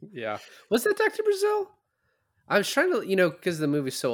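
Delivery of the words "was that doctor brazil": mock high voice. Mocking a voice from the movie.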